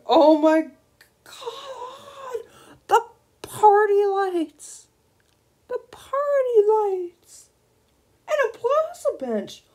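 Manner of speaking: high, tearful squeals; overwhelmed near tears of delight; squealing joy. Real, not acted. Gamer squealing over party lights.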